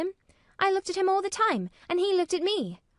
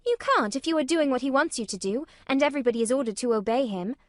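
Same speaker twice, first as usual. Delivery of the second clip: put-on higher girl's voice, louder and fast; arguing; 6 semitones higher, 6 dB louder, faster than usual. A narrator's reading. Mary argues with Martha, quick and insistent. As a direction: push her point hard. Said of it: "Mary argued".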